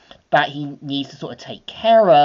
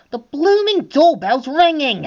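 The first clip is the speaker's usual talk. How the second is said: higher, irritated villainess cry; Cruella annoyed; 11 semitones higher, 3 dB louder than usual. Acted. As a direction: snap at the ringing bell in her voice. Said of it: "de Vil saying".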